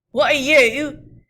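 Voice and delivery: loud, slow demand; guard demanding. Acted. Guard demanding who the rider is, loud. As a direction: demand it hard.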